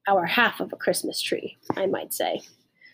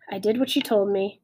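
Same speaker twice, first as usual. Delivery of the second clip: usual speaking voice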